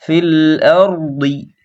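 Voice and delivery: slow ফিল আরদি with a full heavy দ; clear ض. Shown as a demonstration. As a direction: lean into the দ of আরদি. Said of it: "দজ্জের দুই ভালো করে উচ্চারণ করব". (আরদি শব্দের দ ভারী ও স্পষ্ট)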